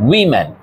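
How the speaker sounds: উইমেন said "wimen", starting with wi; plural pronunciation. Shown as a demonstration. Teacher to students repeating the plural উইমেন. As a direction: repeat উইমেন clearly for the class. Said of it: "এটার উচ্চারণ হচ্ছে উইমেন".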